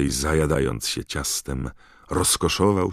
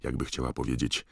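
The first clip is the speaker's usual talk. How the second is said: ordinary delivery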